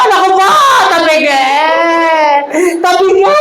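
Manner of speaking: hammed-up grieving cry, rising; mock sobbing shout over the coffin; mock wailing widow. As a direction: wail the name like a widow, half joking. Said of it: "Iyak na naman yung asawa".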